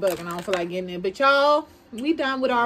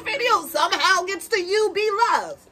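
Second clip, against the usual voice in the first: loud, higher mock accusation; playful blaming; 6 semitones higher, 6 dB louder than usual. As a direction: call her out, teasing. Mock blaming B Love on camera.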